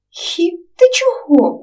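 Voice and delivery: slow giggly question; giggling. Acted. Katya smiles at Romchyk's R drill, giggling. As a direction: giggle as you ask. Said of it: "усміхнулася Катруся".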